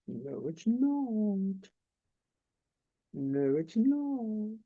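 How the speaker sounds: very slow, soft, drawn-out whisper-like voice; little inner voice. Retold or imitated. Inner voice quietly warning her the two weeks haven't come yet, slow and soft. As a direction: let the doubt creep in, quiet and stretched. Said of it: "a little inner voice goes".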